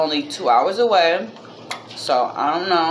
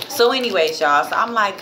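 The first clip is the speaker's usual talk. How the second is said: normal talking voice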